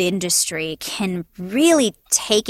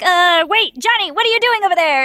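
put-on high, quick calling out to a kid; teacher calling out; 8 semitones higher, 3 dB louder than usual. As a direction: call across the classroom to Johnny.

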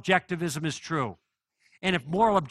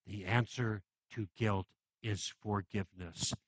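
slow soft repeat; 9 semitones lower, 11 dB quieter than usual. Real, not acted. Repeats the sermon's key line.

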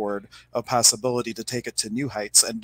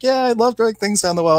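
higher and louder, an eager delighted yes; excited agreement; 11 semitones higher, 5 dB louder than usual. Retold or imitated. Farm woman happily confirming to the psychic reader, excited. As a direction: gush back at her like it's a fond memory.

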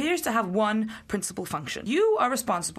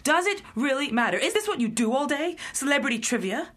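higher, cutting outburst; exasperated; 5 semitones higher than usual. Acted. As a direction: put a stop to the silly chatter. Exasperated boss cuts off trivia.

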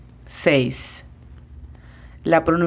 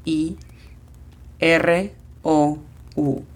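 letters spaced out for learners; spelled slowly for a beginner class; much slower than usual. Shown as a demonstration. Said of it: "La pronunciación fonética sería".

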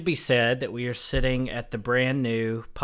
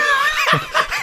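high laugh; 5 dB louder than usual. Real, not acted. Laughing at own story.

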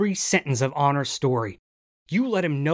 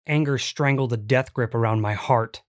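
usual speaking voice

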